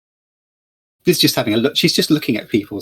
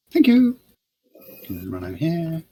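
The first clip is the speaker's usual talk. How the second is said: put-on cheerful young woman; 4 semitones higher than usual. Lily pays and serves.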